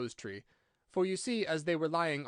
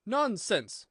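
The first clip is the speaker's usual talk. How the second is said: raised, higher-pitched dismissal; loud and decided; 6 semitones higher, 5 dB louder than usual. A narrator's reading. Alice answering the screaming Queen, loud and decided. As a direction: cut in loudly and firmly. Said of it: "said Alice very loudly and decidedly".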